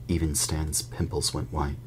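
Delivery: ordinary delivery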